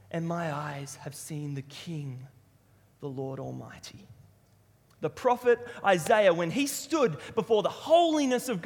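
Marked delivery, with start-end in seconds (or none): 0.1-4.1 slow, soft and low; hushed awe. Acted. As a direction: drop to reverent quiet. Awed end of Isaiah's vision.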